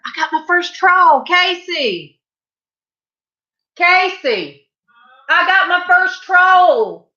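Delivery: high, loud holler to someone in another room; excited calling out. Real, not acted. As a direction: call out the news, drawing out the last words. Calls excitedly to Casey in the house.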